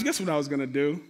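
normal talking voice

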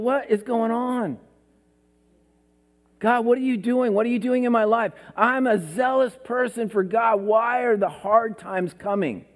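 high, pleading complaint to God; whining Elijah. Retold or imitated. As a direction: whine it out like a self-pitying prophet. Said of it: "He's whining. He's crying".

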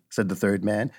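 plain reading voice

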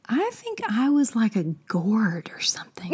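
unvoiced, half-hidden aside; whispering. Real, not acted. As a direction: whisper it sheepishly. Unsure aside about her role.

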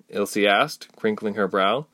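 plain reading voice

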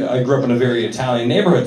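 ordinary talk

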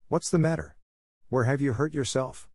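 high-pitched, urgent questioning; demanding. A narrator's reading. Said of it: "demanded Missus Barry".

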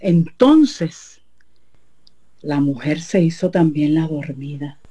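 plain reading voice

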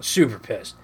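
usual speaking voice

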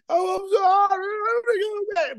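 high pitched whiny apology; mock crying plea. Preacher mocking disobedient people, high whiny plea. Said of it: "now you're crying".

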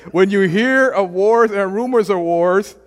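mock doom preacher shouting; mock alarm. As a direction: ham up a doomsday warning. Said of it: "what did the Lord say".

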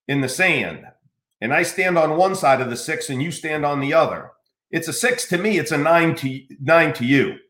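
usual speaking voice

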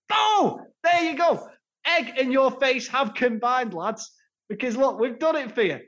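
a big high shouted "there you go", then teasing; hammed-up club gloat, crowing it to the fans; mock gloating reveal. Club teasing fans after faking no launch, mock gloating.

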